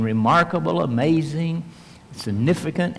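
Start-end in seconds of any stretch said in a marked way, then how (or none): none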